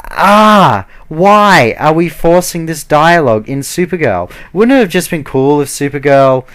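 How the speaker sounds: loud, high groan of exasperation into the question; exasperated outburst. Real, not acted. Vlogger fed up with Supergirl's forced dialogue, exasperated.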